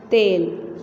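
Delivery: तेल said loudly, the ए pressed hard; with force. Shown as a demonstration. Teacher to pupils, forceful ए in तेल. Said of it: "ज़ोर दे के पढ़ रहे हैं".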